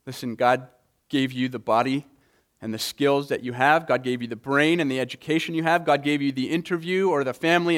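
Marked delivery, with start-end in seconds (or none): none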